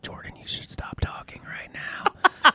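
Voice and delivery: hushed self-warning whisper; inner voice whisper. Retold or imitated. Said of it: "I'm like".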